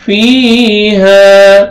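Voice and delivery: فيها with the fi held long; stretched vowel. Shown as a demonstration. Teacher demonstrating the stretched فيها for students. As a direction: draw out the fi in فيها slowly. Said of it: "فا کو آپ نے کھینچنا ہے".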